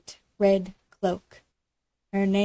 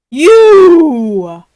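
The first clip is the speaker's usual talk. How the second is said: huge sudden roar; cried; 6 semitones higher, 20 dB louder than usual. A narrator's reading. Wolf springs at Granny with a huge shout. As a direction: pounce on the word with a roar. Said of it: "cried the wolf".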